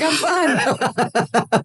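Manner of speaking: fake fine. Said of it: "I'm acting. I'm like".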